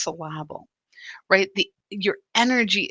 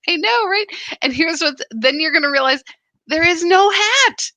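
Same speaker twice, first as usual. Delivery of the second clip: much higher and louder, playful exclaim; giddy delight; 8 semitones higher, 6 dB louder than usual. Real, not acted. Host reacting to Linda's hat remark, giddy exclaiming. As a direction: squeal it with delight at the joke. Laughing at joke about hat.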